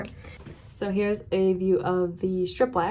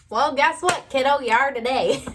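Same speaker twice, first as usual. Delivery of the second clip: higher, louder sassy gotcha; teasing; 6 semitones higher, 6 dB louder than usual. Acted. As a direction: tease the viewer, punchy and playful. Teasing viewers who skip eyeliner.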